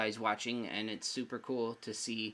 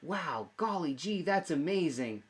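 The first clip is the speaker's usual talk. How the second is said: put-on higher, slower gee-whiz kid voice; wholesome 1950s kid; 6 semitones higher than usual. Shown as a demonstration. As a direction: play an old-timey wholesome kid. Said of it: "You can react and be like".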